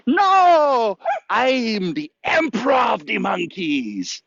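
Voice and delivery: very high, slow squeaky monkey voice; bad acting. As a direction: screech it like a grand monkey emperor. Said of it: "Excuse my acting".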